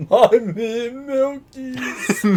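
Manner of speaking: put-on very high, whiny toddler voice; baby voice. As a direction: beg like a little kid for milk. Mocking a baby asking for milk.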